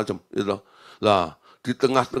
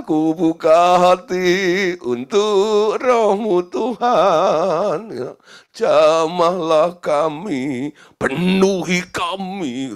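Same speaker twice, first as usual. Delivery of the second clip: drawn-out, raised worship prayer; fervent slow prayer; 5 semitones higher, 5 dB louder, much slower than usual. Retold or imitated. Pastor praying for the Spirit to fill him, slow and fervent. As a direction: pray it slowly, pouring your heart out.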